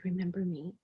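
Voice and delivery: soft, voiced hush of a remembered whisper; lightly imitating a whispered call; hushed, gentle echo of a voice; retold whisper. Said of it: "and it said".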